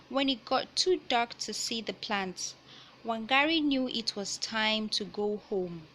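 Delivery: ordinary delivery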